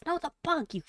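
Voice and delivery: squeaky high mock scolding, then a muttered insult; mock exasperation. Joking reader scolds the imagined listener, mock exasperated. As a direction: snap back at the confused listener in a silly high voice.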